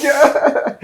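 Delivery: high yeah. Acted. Friend answers.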